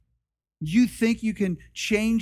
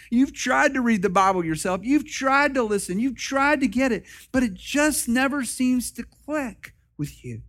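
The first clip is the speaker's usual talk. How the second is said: driving, louder preaching build; fervent preaching; 6 semitones higher, 4 dB louder than usual. Acted. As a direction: preach it hard to the congregation. Pastor preaching at full force.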